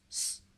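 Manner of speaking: S as a soft unvoiced hiss; a quiet voiceless hiss for S. Shown as a demonstration. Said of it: "d'un S, euh, sourd".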